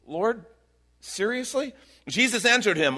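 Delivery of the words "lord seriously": incredulous. Retold or imitated. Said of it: "it's like this incredulous thing".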